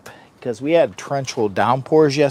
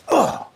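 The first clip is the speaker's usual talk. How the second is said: disgusted groan. Real, not acted. Gooey beard frustration.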